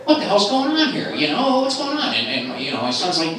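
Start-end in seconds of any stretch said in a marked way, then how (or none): 0.1-2.1 high-pitched alarmed demand; wife freaking out. Retold or imitated. Wife walking in on father and son, mock freak-out. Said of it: "my wife starts freaking out".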